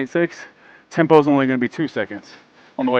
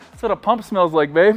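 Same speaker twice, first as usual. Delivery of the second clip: usual speaking voice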